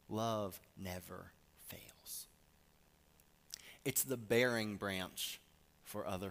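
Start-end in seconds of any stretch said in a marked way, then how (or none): 0.1-2.3 slow, breathy whisper; whispered tenderness. Acted. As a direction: whisper it like a secret to the heart. Tender climax after buildup.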